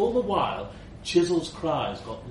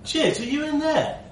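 calling out; 4 dB louder than usual. Acted. Narrator calls into suitcase.

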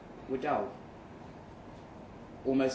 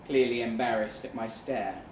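ordinary delivery, nothing marked

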